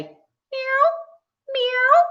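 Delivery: high, imitated cat meow. Teacher shows children what cats say, high meow. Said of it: "What's the cat say?".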